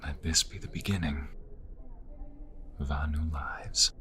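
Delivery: hushed, breathy whisper of a promise; barely voiced, close and intimate; whispered vows. Lover whispering vows to his beloved, softly whispered.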